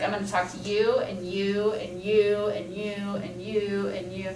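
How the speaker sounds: quiet, mechanical "and you, and you"; sprinkler scanning. Shown as a demonstration. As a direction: hand out attention like a sprinkler. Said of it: "you become a sprinkler".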